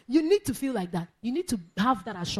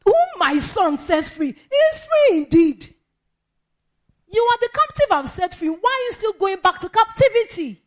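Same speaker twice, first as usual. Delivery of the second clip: high, loud pleading voice of the Lord; voice of Jesus; 10 semitones higher, 9 dB louder than usual. Acted. The preacher voices Jesus asking why the freed go back, high and loud. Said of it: "Lord Jesus is saying".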